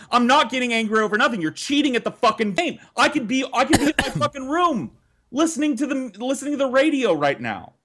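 high, fast, indignant yelling; retold high-pitched yell at the siblings; heated protest. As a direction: shout back, losing your temper. Heated argument.

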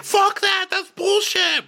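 loud, high-pitched imitated anger; mock outburst. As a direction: blurt it out as hammed-up rage. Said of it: "he wants to be like".

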